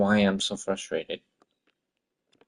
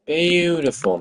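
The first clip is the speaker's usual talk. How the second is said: sarcastic outburst; 7 semitones higher, 5 dB louder, slower than usual. Real, not acted.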